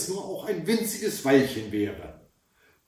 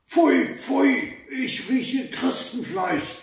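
wild troll roar; 9 semitones higher, slower than usual. Acted. Troll bursts in sniffing for the hidden girls, bellowing. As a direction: roar it out like a troll smelling prey. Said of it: "schrie er schon so laut und wild und barsch".

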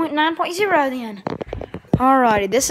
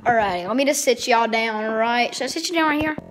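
normal talking voice

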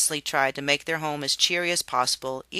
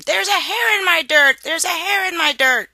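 high shrill-free piercing scream, much higher and louder; screaming child; 9 dB louder than usual. Acted. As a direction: scream it in disgust at the plate. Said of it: "suddenly spit out his food and screamed".

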